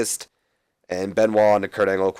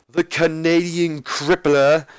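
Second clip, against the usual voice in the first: slow, drawn-out, much higher strutting wrestler promo voice; Ric Flair impression; 7 semitones higher, 3 dB louder, much slower than usual. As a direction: stretch it out like a cocky old wrestling champ. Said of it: "in his best Ric Flair impersonation".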